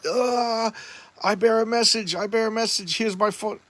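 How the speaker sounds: high, droning put-on voice; hammed-up séance chant, pitched high; mock spirit medium. She teases the witches' rite, high mock trance voice.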